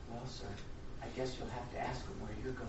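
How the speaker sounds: very soft, low, measured reply; understated punchline after the shouting; quiet comeback. Retold or imitated. Girl to mocking lawyer, quiet and unruffled.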